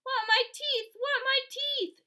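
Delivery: high, loud, slow tantrum; loud high child's tantrum; screaming toddler. A narrator's reading. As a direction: demand it like a wailing toddler. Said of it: "Fudge started to scream".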